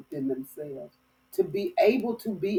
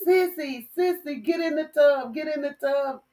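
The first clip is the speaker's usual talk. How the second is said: urgent panic; 7 semitones higher than usual. Retold or imitated. Calling sister from tub in panic.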